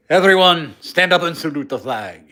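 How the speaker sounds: loud parade-ground call in a hammed-up Chinese accent; jokey Chinese-accented salute command; mock Chinese accent. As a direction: bark it like a cartoon Chinese general. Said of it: "I did that sounding Chinese".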